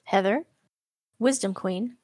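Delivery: usual speaking voice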